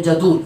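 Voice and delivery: जदूल said with a long u, the wrong way; wrong jadul. Shown as a demonstration. Teacher imitating students' wrong जदूल. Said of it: "जदूल पढ़ते हैं".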